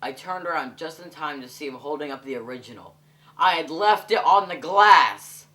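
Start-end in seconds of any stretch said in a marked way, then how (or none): 3.4-5.5 shocked realization. Real, not acted. Realizing he left the original.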